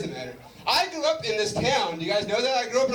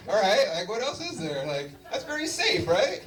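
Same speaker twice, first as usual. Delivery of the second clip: ordinary talk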